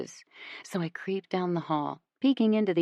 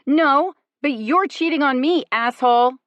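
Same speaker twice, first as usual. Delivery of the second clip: higher and louder, furious yell; 8 semitones higher, 8 dB louder than usual. A narrator's reading. To the boyfriend accusing her, a furious yell. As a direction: yell it at him. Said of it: "I yell".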